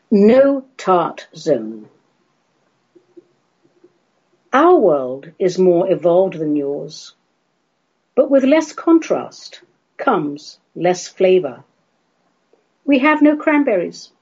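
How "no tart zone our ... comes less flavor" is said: poem reading. Acted. Reads his poem aloud.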